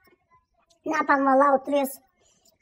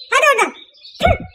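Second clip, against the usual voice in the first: scornful dismissal; 7 semitones higher, faster than usual. Acted. Wife dismisses the neighbour, high and scornful. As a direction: brush her off with scorn.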